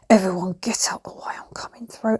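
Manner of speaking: whispered, playfully commanding the road; a whispered boast from a big car; mock bossy whisper. Imagines driving a huge car.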